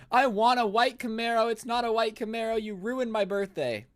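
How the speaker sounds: high, whining spoiled-brat imitation; mock tantrum wail. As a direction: wail like a spoiled girl blaming her dad. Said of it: "here she is sobbing, screaming".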